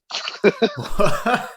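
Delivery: real laugh jumping up high; high burst of laughter. Real, not acted. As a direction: laugh out at the absurdity. Absurd offer to fix fight.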